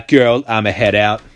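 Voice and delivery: ordinary delivery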